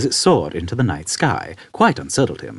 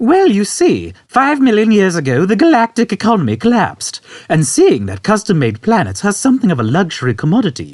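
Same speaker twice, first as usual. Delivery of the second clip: put-on high, louder old man voice; 11 semitones higher, 5 dB louder than usual. Old man explaining to Arthur, high and unconcerned. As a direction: explain it matter-of-factly.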